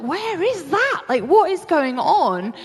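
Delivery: high, slow, drawn-out disbelief; exasperated bewilderment. Retold or imitated. Host retelling confusion at a mystery alarm, exasperated bewilderment. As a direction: stretch out the baffled questions.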